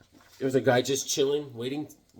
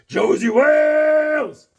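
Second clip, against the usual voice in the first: long, slow, much higher yell; drawn-out shout; 12 semitones higher, 8 dB louder, much slower than usual. Acted. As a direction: bellow the name out. Shouted movie name call.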